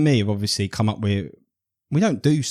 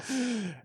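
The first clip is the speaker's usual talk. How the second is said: short laugh; 13 semitones higher, 13 dB quieter than usual. Real, not acted. Laugh after retelling.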